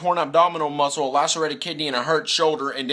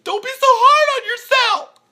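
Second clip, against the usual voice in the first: much higher, slow falsetto comfort; mock consoling; 9 dB louder, slower than usual. Fan consoling Luck behind the screen, in mock falsetto. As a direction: coo it like soothing a crying child.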